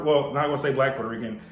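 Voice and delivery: ordinary delivery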